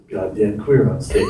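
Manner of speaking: put-on low, slow old-timer's grumble; mock old rancher heckle; old cowboy. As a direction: imitate a disapproving old cowboy, low and unhurried. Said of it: "these two super old dudes, like, proper cowboys".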